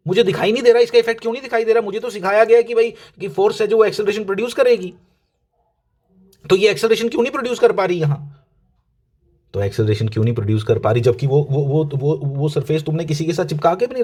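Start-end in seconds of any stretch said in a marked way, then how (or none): 0.1-8.2 higher and faster, playfully flustered; mock panic. Teacher acts a confused student, mock panicking. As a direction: act lost and worried for the class's sake. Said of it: "तो मैं घबरा रहा हूं भाई".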